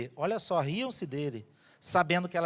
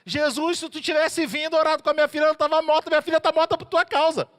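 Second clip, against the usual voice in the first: loud, high, accusing complaint to Jesus; accusing father; 10 semitones higher, 6 dB louder than usual. Acted. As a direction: blame him at full voice. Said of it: "Quem ia falar assim".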